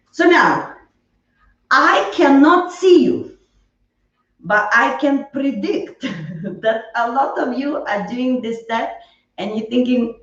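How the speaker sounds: ordinary delivery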